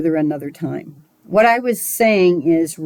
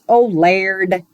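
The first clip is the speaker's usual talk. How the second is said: wannabe Irish "Lord", vowel turned to "laird"; badly faked Irish accent. Shown as a demonstration. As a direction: attempt an Irish accent on a short prayer. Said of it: "I use an Irish".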